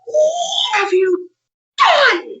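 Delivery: high, crying, shouted "what have you done"; panicked cry. Retold or imitated. Wife cries out at husband over mowed fairy ring, panicked. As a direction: wail it out in panic. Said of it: "I go rushing in the house".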